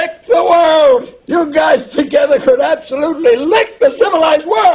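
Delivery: very high, loud rallying cry; exultant shout. Acted. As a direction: shout it like a triumphant rallying call. Father cheering sons' plan.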